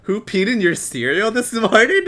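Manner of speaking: high, loud disbelief; loud incredulous retort. Acted.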